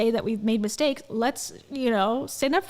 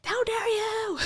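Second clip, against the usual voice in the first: high, theatrical offended voice; mock indignant filmmakers; 11 semitones higher, slower than usual. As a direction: play it hammed-up offended.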